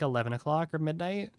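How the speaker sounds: ordinary talk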